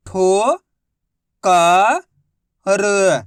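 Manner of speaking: ठो, क, र said as separate loud syllables with pauses. Shown as a demonstration. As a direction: sound out ठो, क, र one syllable at a time. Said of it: "एक ही अक्षर का उच्चारण करना है".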